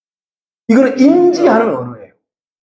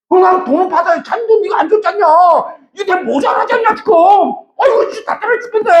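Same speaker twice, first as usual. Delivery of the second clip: much higher, fast, frustrated pleading; exasperated arguing; 11 semitones higher than usual. Retold or imitated. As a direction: act out losing patience with the clerk. Retold quarrel over wrong change. (답답해서 높고 빠르게 따지는 말투)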